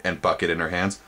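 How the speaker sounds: ordinary delivery, nothing marked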